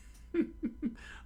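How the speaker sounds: high, breaking-up laugh; real amused giggle; real laughter. Real, not acted. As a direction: crack up at the writing. Reader cracks up at the story.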